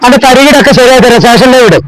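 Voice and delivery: high, forceful officer's scolding; angry ordering. Acted. Officer catching a suspect and ordering him in, angry. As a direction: herd him in with authority.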